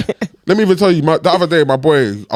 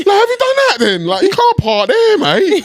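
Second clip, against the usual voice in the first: mock shouting at the driver. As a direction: yell at a stranger, laughing through it. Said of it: "you know when people".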